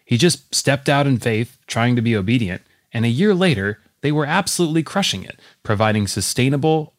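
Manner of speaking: ordinary talk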